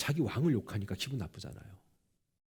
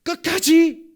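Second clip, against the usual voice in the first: 끝까지 shouted much higher and louder; stress on 끝까지; 14 semitones higher, 13 dB louder, much slower than usual. Acted. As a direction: hit 끝까지 hard and high. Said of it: "섭리가 있는 사람은요". (끝까지를 높고 크게 외치며 강조)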